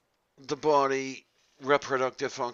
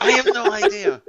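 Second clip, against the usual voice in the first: laughing; 10 dB louder than usual. Real, not acted. Laughing at own nonsense.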